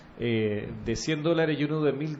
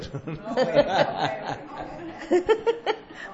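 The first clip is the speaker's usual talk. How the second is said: laughter; 10 semitones higher than usual. Real, not acted. Laughter ending the topic.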